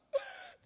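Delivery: very high, loud fake sob; hammed-up wail of grief; mock sobbing. Preacher imitating a bereaved child, loud sobbing. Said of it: "orang yang nangis gitu".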